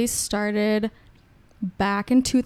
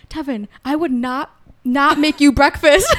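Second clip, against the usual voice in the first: louder, higher, half-laughing indignation; play-offended at the boyfriend; mock indignant protest; 4 semitones higher, 6 dB louder than usual.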